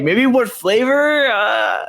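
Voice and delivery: high, drawn-out unsure question; slow, high-pitched tentative answer; clueless guess. Retold or imitated. Acting out a clueless classroom answer.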